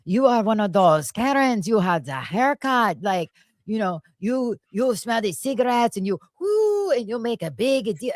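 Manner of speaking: mock-haughty French accent scolding. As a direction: retell it in a put-on French accent. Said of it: "this French accent started coming out of her mouth".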